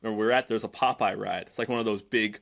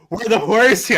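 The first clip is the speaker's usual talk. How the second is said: high, loud frantic panic; panicked search; 10 semitones higher, 11 dB louder than usual. Retold or imitated. Lost kid searching for dad, panicked. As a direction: blurt it out searching wildly for dad.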